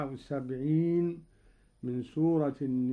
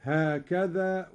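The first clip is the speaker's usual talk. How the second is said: normal talking voice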